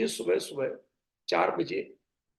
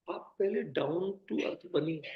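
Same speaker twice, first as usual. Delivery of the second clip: ordinary talk, nothing marked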